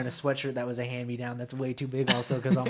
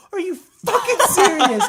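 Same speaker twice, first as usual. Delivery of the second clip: high, loud, slow outraged disbelief; disbelieving stepmom; 12 dB louder than usual. Retold or imitated. Stepmom hearing the stranger's ice cream story, outraged. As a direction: let the disbelief explode.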